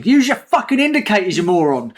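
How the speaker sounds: irate driver's loud, high-pitched yell; shouting. Acted. Host shouting at an imagined bad space driver, mock road rage. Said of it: "flashing the headlights and shouting".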